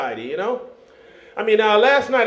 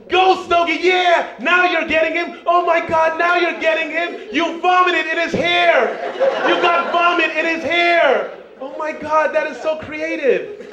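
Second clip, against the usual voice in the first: cheering sisters; 6 semitones higher than usual. Retold or imitated. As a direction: yell encouragement like a rowdy cheerleader. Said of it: "they would start cheering".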